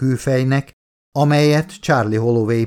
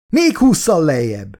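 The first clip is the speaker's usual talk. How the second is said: higher-pitched urgent narrated yell; 6 semitones higher, 4 dB louder than usual. A narrator's reading. Doctor yells as the twitching only grows, urgent yell. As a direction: raise the pitch and push the order out. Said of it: "üvöltötte már Elizabeth".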